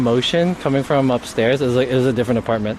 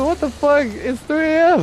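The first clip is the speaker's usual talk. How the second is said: mock disbelief; 13 semitones higher than usual. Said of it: "Like, dude".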